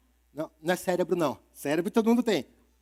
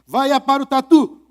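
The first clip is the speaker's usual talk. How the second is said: raised shout to the armadillo; calling out; 6 semitones higher, 9 dB louder than usual. Acted. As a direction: call out to him loud and high. Animals calling out in story.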